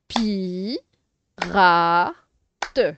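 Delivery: "Pi. Ra. Te." in three separate beats, loud on Ra; syllable by syllable. Shown as a demonstration. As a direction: sound out "Pi. Ra. Te." with pauses. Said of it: "en séparant les syllabes".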